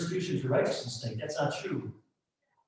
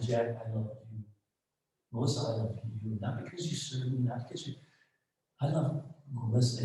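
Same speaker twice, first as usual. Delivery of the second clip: tender voice of God; 3 semitones lower, 7 dB quieter than usual. Retold or imitated. God's personal word, tender.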